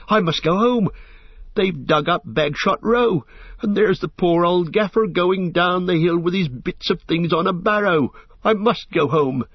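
high, agitated pleading pitch throughout; wild distress. A narrator's reading. Sam seeing his home ruined, wildly distressed. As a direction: cry it out, desperate to get home. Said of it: "he said wildly".

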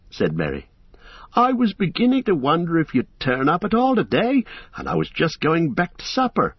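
put-on cheerful young hobbit. A narrator's reading. Merry greeting the frightened travellers, amused. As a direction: tease them for being scared.

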